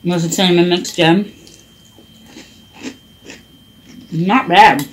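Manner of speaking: talking while chewing cereal; eating between words; mouth full. Real, not acted. Seen 0: Bite at -1.0, talks over bowl, spoons more cereal 4-6s.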